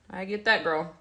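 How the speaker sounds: ordinary talk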